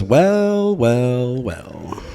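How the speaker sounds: drawn-out wells stepping down in pitch; knowing gotcha. Retold or imitated. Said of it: "she goes".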